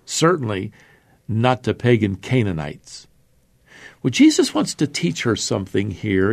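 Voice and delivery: normal talking voice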